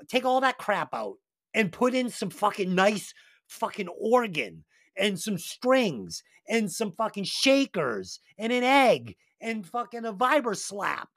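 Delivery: ordinary delivery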